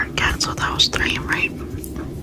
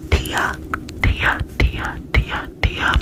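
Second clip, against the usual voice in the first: tingly asmr mouth sounds; much slower than usual. Acted. Trigger word repetition.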